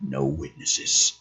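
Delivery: low, soft regretful order, slowly; a heavy, regretful verdict; deep regret. A narrator's reading. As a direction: say it as if it costs him. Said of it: "Trigger sighs, deep regret".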